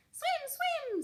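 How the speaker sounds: very high little gosling saying a name; high, small baby goose; tiny gosling voice. A narrator's reading. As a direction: chirp it out like a baby bird. Gosling excited to swim.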